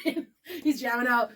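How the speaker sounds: usual speaking voice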